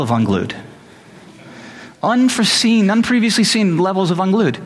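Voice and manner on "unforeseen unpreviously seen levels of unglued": high-pitched comic emphasis; worked-up exaggeration. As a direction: hammer home how unhinged it got. Recounting his own meltdown, exaggerating.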